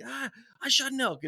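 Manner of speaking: high mock holler; high-pitched imitation of shouting; retold scream. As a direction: holler the news across the woods. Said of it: "Is just screaming at each other".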